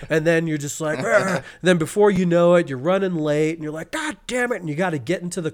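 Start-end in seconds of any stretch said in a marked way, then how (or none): 1.0-1.4 higher mock "argh"; frustrated growl. Imitating someone whose morning goes wrong, frustrated growl. 3.9-4.6 high, strained mock curse; exasperated curse. Acting out running late for work, high exasperated curse.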